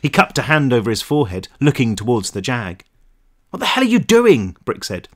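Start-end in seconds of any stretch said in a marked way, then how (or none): 3.5-4.6 high incredulous challenge; higher-pitched agitated question; alarmed protest. A narrator's reading. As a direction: demand it in exasperation. Brick challenges friend's reckless plan.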